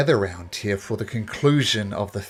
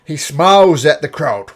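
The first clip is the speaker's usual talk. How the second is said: normal talking voice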